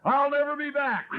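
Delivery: high-pitched hammed-up farewell call; mock parting shout. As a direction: call it out as a dramatic last word. Departing husband calls to the neighborhood.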